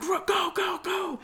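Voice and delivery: high, urgent yell of go; panicked shout. Retold or imitated. Fleeing into the car, panicked high shout. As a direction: scream at your brother to drive now.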